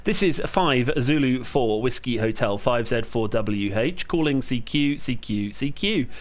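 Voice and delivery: steady, clipped radio call sign readout; radio call procedure. Real, not acted. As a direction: call it out like a ham operator. Calling CQ on ham radio.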